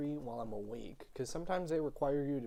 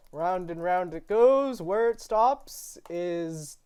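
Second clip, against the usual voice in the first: slow, drawn-out suspense build, higher and louder; game-show announcer; 5 semitones higher, 8 dB louder, much slower than usual. Pretend. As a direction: stretch it out like spinning a prize wheel. Announcing the random generator's result.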